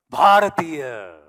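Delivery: slow, loud, drawn-out Bharatiya; proud loud answer. Real, not acted. Speaker answering the audience's question, loud and slow with pride. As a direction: ring it out with pride. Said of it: "Tell me, tell me".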